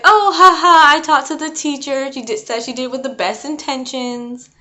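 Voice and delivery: high, loud fake laugh through the words; mocking laugh. Principal laughing off the mom's call, mock-laughing. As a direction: laugh it off smugly, dismissive. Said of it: "she laughs at my mom".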